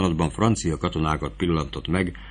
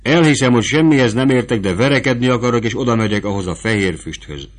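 put-on higher, louder, rushing eager boy; young recruit insisting, higher and louder; eager young Fabrizio; 5 semitones higher, 6 dB louder than usual. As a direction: blurt out that you want to fight. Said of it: "felelte Fabrizio".

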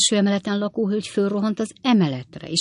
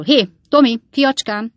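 put-on higher, louder call to a child; 6 semitones higher, 8 dB louder than usual. A narrator's reading. Klappinsné calls to young Tommy, loud and coaxing. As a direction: hail the boy to get his attention.

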